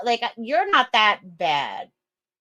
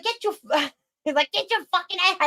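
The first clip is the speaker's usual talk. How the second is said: higher mock New York coach yelling; 9 semitones higher, faster than usual. Retelling the football coach yelling at his team, in a higher mock New York accent. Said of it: "straight New York".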